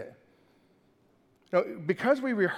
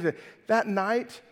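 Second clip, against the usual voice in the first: ordinary talk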